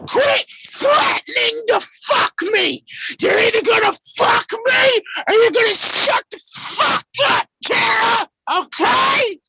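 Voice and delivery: tearful shouting. Real, not acted. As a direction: shout it through tears. Drunken furious stream fight.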